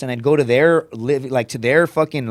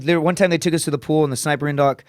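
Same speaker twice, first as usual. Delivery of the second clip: usual speaking voice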